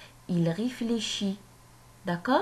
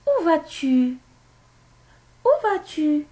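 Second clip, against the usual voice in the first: très haut et fort, montée de question; question modèle; 8 semitones higher, 7 dB louder than usual. Shown as a demonstration. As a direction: lance la question bien haut, pour la classe. Relit la question exemple. (question posée très haut et fort)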